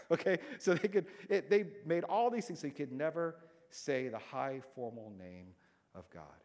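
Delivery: ordinary talk, nothing marked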